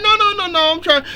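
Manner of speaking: squeaky high, loud mock plea; mock panicky denial. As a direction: squeal it like a panicking man caught out. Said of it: "He's like".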